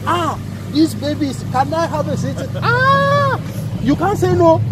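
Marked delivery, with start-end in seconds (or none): 2.6-3.4 a high, delighted scream of joy; excited squeal. Real, not acted. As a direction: let out a thrilled high-pitched yelp. Excited before sitting in the car.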